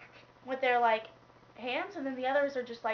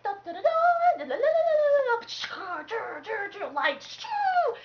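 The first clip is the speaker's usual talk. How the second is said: mock trumpet fanfare; 11 semitones higher, 9 dB louder, much slower than usual. Mock trumpet blast in retold battle.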